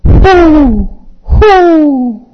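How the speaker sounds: high, loud owl hooting. Acted. Owl teacher on the desk hoots at the student, high and loud. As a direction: hoot like a proud, wise owl. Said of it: "calmly he looks into your eyes and he says".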